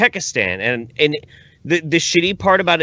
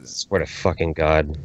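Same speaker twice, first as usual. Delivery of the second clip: ordinary delivery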